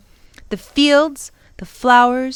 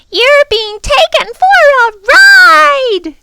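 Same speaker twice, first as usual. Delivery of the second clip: very high, loud, slow screech; screaming bird; 12 dB louder, slower than usual. Acted. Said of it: "she screamed for the third time".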